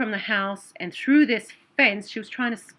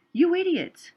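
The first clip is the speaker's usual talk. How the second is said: self-scolding; 10 semitones higher, 4 dB louder than usual. Retold or imitated. Scolding herself in memory.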